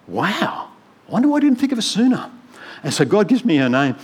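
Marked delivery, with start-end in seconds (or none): 0.1-2.3 much higher and fast, delighted; surprised realisation. Retold or imitated. Pastor recalls thinking of Margaret, surprised, higher and faster. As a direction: rush out the sudden idea. Sudden idea about Margaret, retold.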